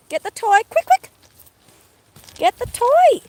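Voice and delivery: very high, loud urging; squeaky dog voice. Real, not acted. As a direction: hurry the dog along, high.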